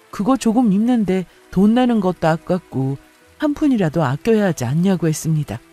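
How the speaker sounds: usual speaking voice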